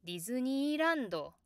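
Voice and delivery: Japanese Disneyland with the high pitch climbing further up before dropping; emphasized in surprise. Shown as a demonstration. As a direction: stretch Disneyland slowly upward as if surprised. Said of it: "If you emphasize the word in surprise".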